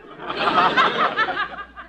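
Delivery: nervous laugh. Acted.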